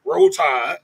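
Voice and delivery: Alabama game-day Southern drawl; thick Alabama accent on a team cheer; Southern football-fan accent. Shown as a demonstration. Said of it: "I'm doing the other Southern accent".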